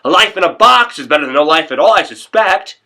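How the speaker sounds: loud, high rant building toward a shout; agitated rant. Acted. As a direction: work yourself up over lying dead in a box. Agitated rant about being dead.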